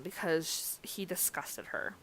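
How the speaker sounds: ordinary delivery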